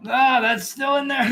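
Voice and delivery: loud, high, drawn-out belly laugh; bellowing laughter. Acted. Shopkeeper bellows at the players, roaring with laughter. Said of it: "bellows laughter".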